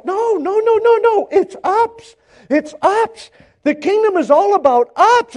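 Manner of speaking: high-pitched mock protest; acted-out refusal to hear it; insistent objection. Acts out insistent objection to suffering.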